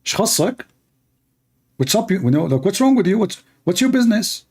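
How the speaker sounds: put-on high, angry Saudi accent. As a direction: snap back in a Saudi accent. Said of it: "in Saudi accent".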